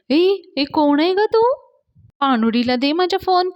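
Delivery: high, loud put-on girl voice; shouting in a girl's voice. Dhanya demands the phone as a girl, high loud shout. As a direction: yell like an indignant girl. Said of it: "मुलगीच्या आवाजात हां मंडळी".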